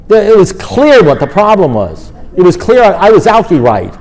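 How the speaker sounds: retold self-righteous tirade; worked-up rant. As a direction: rant indignantly, tripping over your words. Re-enacts angry rant to sponsor.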